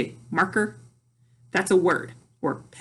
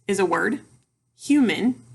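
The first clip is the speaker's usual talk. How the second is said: ordinary talk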